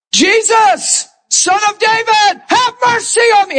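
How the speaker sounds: high, lifted cry for mercy; crying out to Jesus. Acted. Blind man cries out to Jesus, high pleading call. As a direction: call out above the crowd. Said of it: "he rises above the noise".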